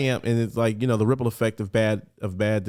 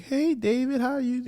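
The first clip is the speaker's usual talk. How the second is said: much higher, forced bright greeting; fake cheery small talk. Aunt on the phone hiding bad news, forced cheerful pitch. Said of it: "worst chit chat in the world".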